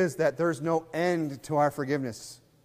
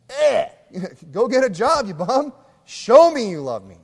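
higher, louder laughing jab; mock scolding; 7 semitones higher, 9 dB louder than usual. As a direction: shove the bum out the door with a laugh. Telling the husband off.

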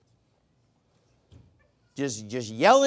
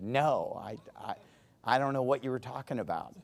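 softer, lower, hesitant voice; quiet retold reply; 6 semitones lower, 8 dB quieter than usual. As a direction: imitate a listener's low, halting denial. Retelling a stranger's puzzled answer.

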